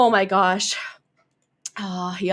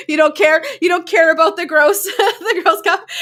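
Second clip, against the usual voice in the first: much louder, higher, laughing through it; 8 semitones higher, 8 dB louder than usual. Real, not acted. Host teasing viewers about her gross cup, laughing loudly. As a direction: burst out laughing at them.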